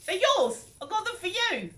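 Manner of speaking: imitating the friend giving the gift, pitched high; friend's voice. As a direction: try the friend's sweet voice, not quite landing it. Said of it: "I can't even do the voice".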